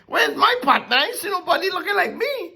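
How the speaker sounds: high, fast voice of the puzzled first man; Adam looking around for a partner, excited and high. Retold or imitated. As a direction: play Adam spotting no partner, laughing as he goes. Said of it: "He's like".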